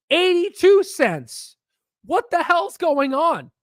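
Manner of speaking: incredulous wail at the price; high, slow disbelieving rant. Retold or imitated. Investor ranting to viewers about a collapsed stock, high and slow.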